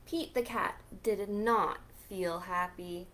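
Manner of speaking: usual speaking voice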